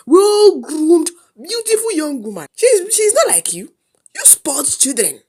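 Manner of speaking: high, loud gloating tirade; worked-up uncle, rushing then stretching words; scornful, angry uncle. Acted. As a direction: scold the children with mocking triumph.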